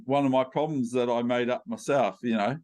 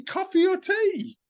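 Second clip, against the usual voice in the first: much higher, playfully insistent question; insistent repeat. Real, not acted. Host repeats question to guest who said sorry, insistent. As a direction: repeat it high-pitched, as if they heard perfectly well.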